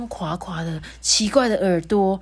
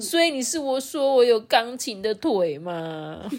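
crying hippo; 6 semitones higher than usual. Acted. Rourou takes a remark as an insult, wailing in hurt. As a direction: wail it out like a hurt crybaby. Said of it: "一直哭".

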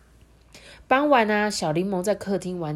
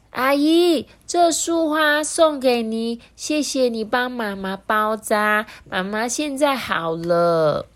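put-on slow, higher child voice, carefully spoken; grateful child; 7 semitones higher, 4 dB louder, slower than usual. A narrator's reading. Little Lemon gives auntie flowers, grateful and careful. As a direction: deliver thanks one deliberate phrase at a time.

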